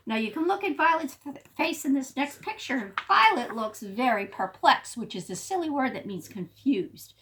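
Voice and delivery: plain reading voice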